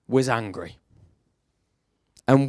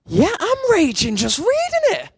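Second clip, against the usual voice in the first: much higher, mock-outraged exclamation; mock raging. Preacher voicing his own mock rage at the story, much higher. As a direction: play up your own outrage for laughs. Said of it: "I read that and I go".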